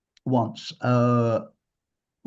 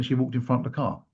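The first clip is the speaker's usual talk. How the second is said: ordinary talk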